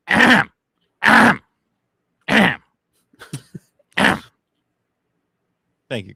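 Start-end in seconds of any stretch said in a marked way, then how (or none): 0.1-4.2 loud, high mock throat clearing. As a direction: clear your throat loudly, on cue. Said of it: "Clear your throat".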